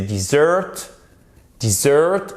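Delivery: dessert as dih-ZURT; short I, late stress. Shown as a demonstration. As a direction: stress the second syllable of dessert. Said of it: "accentuation ici, le E se prononce comme un I court".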